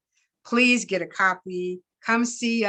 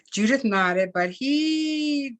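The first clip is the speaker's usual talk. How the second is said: plain reading voice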